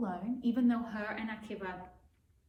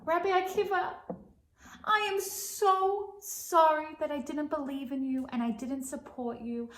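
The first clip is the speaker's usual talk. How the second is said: high, loud begging; father begging Rabbi Akiva and Rachel; pleading father; 7 semitones higher, 6 dB louder than usual. Acted. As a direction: plead for forgiveness. Father begs forgiveness.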